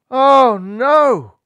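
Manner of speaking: loud high shocked cry; the crowd sees him knocked out; gasp of dismay. A narrator's reading. Supporters watching Rajban fall, a shocked cry. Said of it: "gasped the Bunbury supporters".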